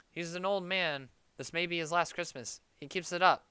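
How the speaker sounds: ordinary talk, nothing marked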